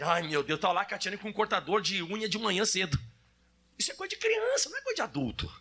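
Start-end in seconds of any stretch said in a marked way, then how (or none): 0.0-0.6 a mom rushing for the clippers; fussed mother. Retold or imitated. Preacher voicing his wife fussing, lower. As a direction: lower mock exasperated sigh.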